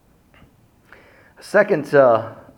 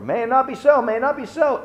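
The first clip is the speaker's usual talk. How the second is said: much higher, pleading; disciples' protest; 11 semitones higher, faster than usual. Retold or imitated.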